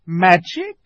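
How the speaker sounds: high, loud imperious witch; witch's challenge. A narrator's reading. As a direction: challenge them as a haughty witch. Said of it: "asked the witch".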